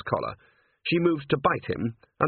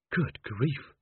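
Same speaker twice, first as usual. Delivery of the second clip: breathy; 2 semitones higher, 4 dB quieter than usual. A narrator's reading. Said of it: "breathed Angua".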